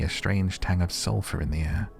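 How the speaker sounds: ordinary delivery, nothing marked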